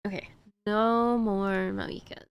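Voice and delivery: normal talking voice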